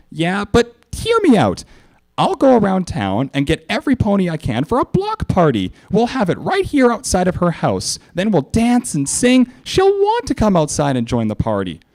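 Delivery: high, eager bouncy pony; Pinkie voice. A narrator's reading. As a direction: sell the plan excitedly. Pinkie pitching party.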